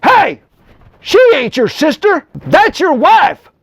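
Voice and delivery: high, loud, outraged yell, slow at the end; shocked ruler bellowing at the man who lied about his wife; scandalized king. Retold or imitated. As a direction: shout it like a king catching a lie. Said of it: "is scandalized and goes".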